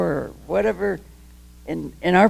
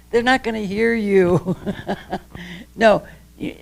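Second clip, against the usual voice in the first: ordinary talk, nothing marked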